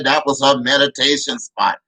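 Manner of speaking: normal talking voice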